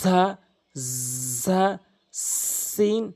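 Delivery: "ঝা" as long buzzed z, "সিন" with long s; demonstrated za and sin. Shown as a demonstration. Teacher models whistling letters, "ঝা" and "সিন" with sustained sibilants. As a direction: hold the opening consonant of "ঝা" and "সিন". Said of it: "এভাবে উচ্চারণ করবেন".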